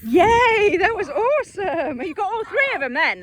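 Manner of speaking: high, loud celebration; delighted cheering. Real, not acted. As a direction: burst out cheering for the dogs. Cheering successful recall.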